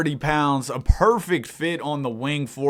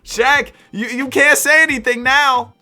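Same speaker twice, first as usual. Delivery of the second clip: excited; 9 semitones higher, 3 dB louder than usual. Real, not acted. Teasing Shaq over the turnaround.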